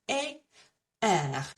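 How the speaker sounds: the two vowels of "préfère" apart, "Et", then "er"; accent sounds isolated. Shown as a demonstration. Teacher isolating the accents for learners, two separate vowel sounds. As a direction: separate closed "Et" from open "er". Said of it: "what those accents are doing".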